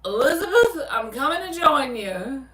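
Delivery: high, loud mock cry to heaven, laughing through it; Fred Sanford impression. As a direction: ham up the old man calling to his late wife. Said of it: "I'd be like that man on Sanford and Son".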